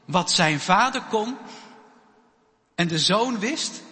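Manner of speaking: normal talking voice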